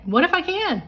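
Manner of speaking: "What if I can?" high and eager; excited what if. Shown as a demonstration. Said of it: "Say it".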